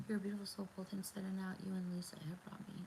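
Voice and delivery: hushed, touched read of a kind comment; quiet and moved, reading a compliment; soft reading aloud. Real, not acted. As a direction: murmur the viewer's words softly. Reading sweet comment softly.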